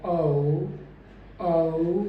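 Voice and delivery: औ said cleanly as one glide; diphthong demo. Shown as a demonstration. Teacher names the sound औ, modelling it. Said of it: "वो sound है".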